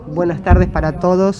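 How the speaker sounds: ordinary talk, nothing marked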